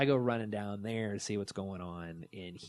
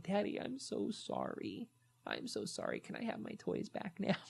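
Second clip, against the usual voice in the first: put-on pleading child; 10 semitones higher, 4 dB quieter than usual. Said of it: "it's at that point".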